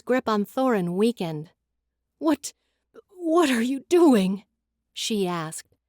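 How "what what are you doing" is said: afraid. Acted. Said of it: "fear flickering in her eyes".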